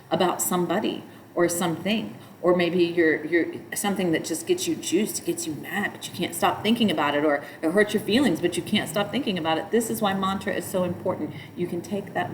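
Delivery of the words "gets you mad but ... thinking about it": ordinary delivery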